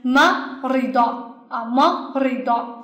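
first syllable ma stressed, ri do trailing after. Shown as a demonstration. Teacher models the third word for students, stress on ma. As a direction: sound out the word with the weight on ma. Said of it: "这个重音在me上".

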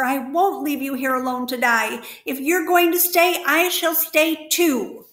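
the pig will not leave his friend; frantic vow. Acted. Wilbur's frantic vow to stay.

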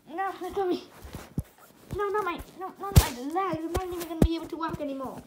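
high, loud panicked begging; pained pleading. Acted. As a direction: plead as your legs are torn. Character begging while being hurt.

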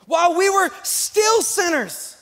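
fervent pulpit crescendo; full-force preaching. Acted. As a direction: preach it to the back row.

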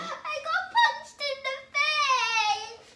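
slow, loud, high-pitched mock bawling; fake crying. Girl telling mum the prank story, fake crying. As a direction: wail every word out long. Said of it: "why are you crying".

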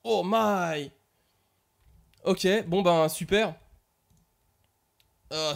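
normal talking voice

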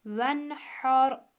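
slow recitation of "venhar" with a thick, full ra; heavy ra recitation. Shown as a demonstration. (kalın ra ile tilavet)

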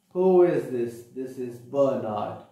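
usual speaking voice

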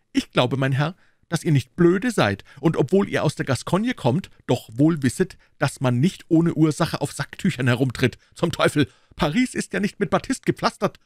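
high, emphatic scolding voice; Aramis. A narrator's reading. Musketeer scolds the Gascon over the handkerchief, high.

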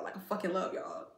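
normal talking voice

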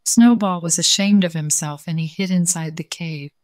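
usual speaking voice

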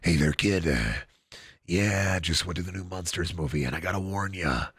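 deep, hushed warning from a grizzled stranger; gruff old-timer voice. Pretend. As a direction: lean in low and warn the kid. Gruff character warns about movie.

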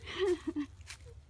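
bursting out laughing; real laugh at the situation; loud laugh. Real, not acted. Laughing at being lost.

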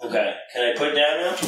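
ordinary talk, nothing marked

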